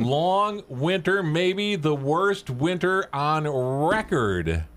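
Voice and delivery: high, slow, drawn-out forecast; weatherman character. Acted. Joke teller voices the weatherman warning the boy, slow dramatic forecast. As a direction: stretch out each dire word for effect.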